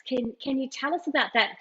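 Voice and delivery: ordinary talk, nothing marked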